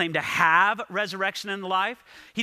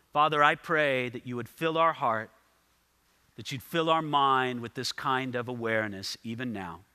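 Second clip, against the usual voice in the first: closing prayer; 6 semitones lower than usual. Acted. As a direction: lower the voice and pray with bowed reverence. Closing prayer before communion.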